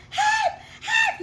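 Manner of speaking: high shouted help; panicked shout; cry for help. Acted. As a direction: yell like you're drowning. Peter sinking, calling out.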